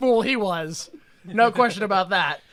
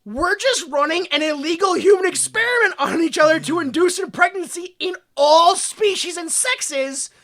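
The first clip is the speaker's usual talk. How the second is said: loud, high, slowed panicked denial; mock flustered shouting denial; 8 semitones higher, 7 dB louder than usual. Two men caught out, denying loudly and flustered. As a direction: protest way too hard. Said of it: "They go".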